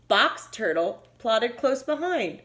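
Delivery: plain reading voice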